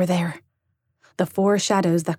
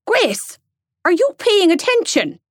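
much higher, louder teacher call; stern teacher; 13 semitones higher, 5 dB louder than usual. Acted. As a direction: snap a dreaming pupil back. Teacher calling daydreaming pupil.

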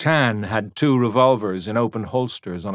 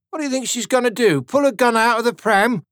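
much higher, fast mocking voice; mocking young soldier; 13 semitones higher, 5 dB louder than usual. A narrator's reading. Young Tommy to the tan, high and mocking. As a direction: laugh it off at the tan.

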